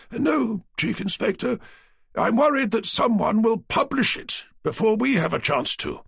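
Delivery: defending the company's interests; stiff publisher. A narrator's reading. Naylor, firmer now.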